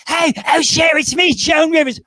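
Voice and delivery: high, loud, brassy shout of a greeting. Retold or imitated. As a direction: yell down the phone as a pushy comedian. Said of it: "they'd have to say".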